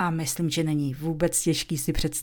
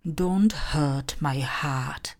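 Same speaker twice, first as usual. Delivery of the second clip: very slow, clear dictation; slow model sentence for students; slow example; much slower than usual. Shown as a demonstration. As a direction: spell it out word by word. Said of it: "Například".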